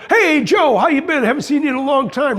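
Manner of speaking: high, loud hearty hello; cheery salesman greeting. Retold or imitated. Salesman greets an old friend, high and hearty. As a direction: greet an old pal with a big hail. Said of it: "Wally said to him".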